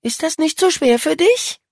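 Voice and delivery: high-pitched, spiteful jab; venomous. A narrator's reading. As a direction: needle him with a high, snide question. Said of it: "giftete Paula". (giftig und hoch gestichelt)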